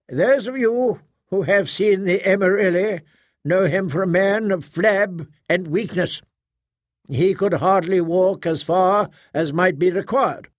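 proctor holding forth. Acted.

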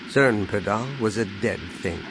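ordinary delivery

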